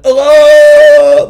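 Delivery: high, loud wail of a screaming woman; imitated scream. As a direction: let out a wail over the river railing. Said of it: "screaming over the railing".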